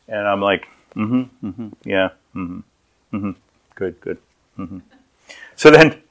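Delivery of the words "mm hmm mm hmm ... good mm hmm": quiet, distracted murmurs of agreement; half-listen and mumble along; absent-minded. Husband reading while wife talks, absent-minded.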